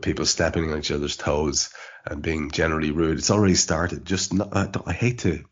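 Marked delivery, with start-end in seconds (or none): none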